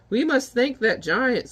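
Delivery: high, slow witchy voice; witch voice. Acted. Witch relishing the stinky soot in her pot, high slow voice. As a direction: savour the stench like a cackling witch. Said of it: "witches decide".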